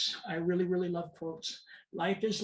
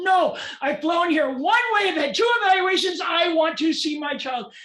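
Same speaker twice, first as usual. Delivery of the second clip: angry protest; 9 semitones higher, 10 dB louder than usual. Retold or imitated. Parent refusing the judge's order to go home, angry shouting. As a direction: shout it at full force, refusing to back down.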